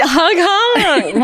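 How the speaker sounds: high, slow honking like a horn; slow air horn honk. Pretend. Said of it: "Give me that air, air horn".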